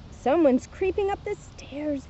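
plain reading voice

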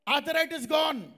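loud, drawn-out declaration; triumphant proclamation. Real, not acted. Preacher announcing a healing to congregation, triumphant. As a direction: proclaim it slowly and loudly.

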